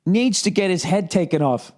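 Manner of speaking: ordinary talk, nothing marked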